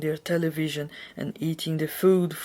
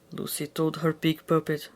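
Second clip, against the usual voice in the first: usual speaking voice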